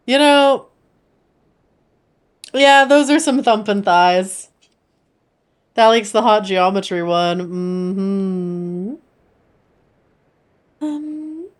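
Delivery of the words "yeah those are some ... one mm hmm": ordinary talk, nothing marked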